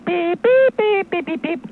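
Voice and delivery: high mouth-made phone beeps; imitated phone beeps. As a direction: mimic the line ringing. Phone ringing on a call.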